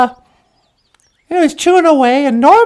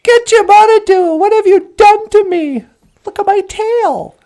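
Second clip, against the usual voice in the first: higher anguished plea; crying out; 6 semitones higher, 3 dB louder than usual. Acted. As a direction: wail to the Creator. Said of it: "He was like".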